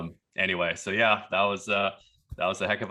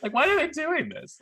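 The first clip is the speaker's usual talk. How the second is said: high, loud bewildered question; baffled; 4 dB louder than usual. Retold or imitated. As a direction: puzzle over it out loud. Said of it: "we're just sitting there thinking".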